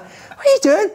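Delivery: imitated high-pitched squawk of disbelief; mock incredulous. Imitating a teammate next morning, high and incredulous. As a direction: squeal it as a baffled question. Acted outraged reaction in story.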